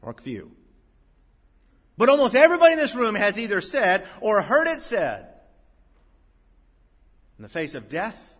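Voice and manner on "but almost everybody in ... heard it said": high, loud pulpit delivery; full-force preaching. Acted. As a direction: drive it home from the pulpit.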